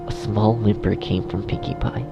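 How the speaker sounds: ordinary delivery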